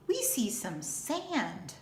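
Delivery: normal talking voice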